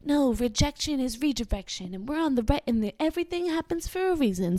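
acted-out positivity; super positive. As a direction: chirp a motto as if quoting yourself. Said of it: "being super positive about things".

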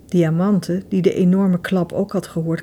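ordinary delivery

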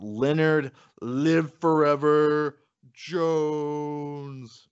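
big ring-announcer build on the name; boxing announcer. Shown as a demonstration. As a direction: call the name like a fight intro. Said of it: "I have to say it like a boxing announcer".

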